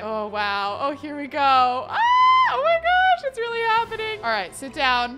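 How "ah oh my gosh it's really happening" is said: high, loud gasp of excitement; excited squeal. Real, not acted. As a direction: squeal with thrill, then ease slightly. Wedding starts, excited squeal.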